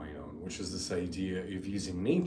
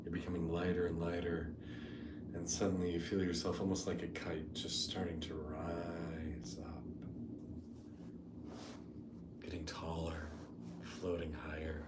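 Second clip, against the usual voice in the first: soft, unhurried meditation voice with long pauses; guided meditation; 7 dB quieter than usual. Real, not acted. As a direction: ease them gently into the imagery.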